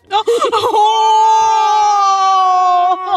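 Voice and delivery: big howling laugh, loud; real laugh bursting out; loud burst of laughter. Real, not acted. Cohost cracks up at Don Cheto.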